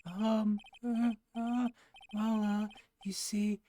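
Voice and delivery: high, hesitant confession; high stammering criminal. Acted. Suspect nervously confessing to his wife, high stammering voice. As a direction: stutter out that you're the thief.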